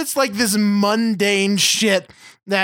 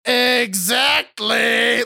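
smug uncle; 3 semitones higher, much slower than usual. Retold or imitated. Uncle Steve finally answering from the corner, very slow mock-smug shout. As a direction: stretch it out like the uncle who thinks he nailed it. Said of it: "Steve in the corner's like".

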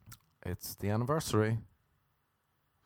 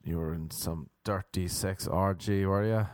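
usual speaking voice

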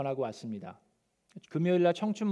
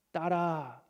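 tender; 5 dB quieter, much slower than usual. Acted. Jesus tenderly addresses the woman.

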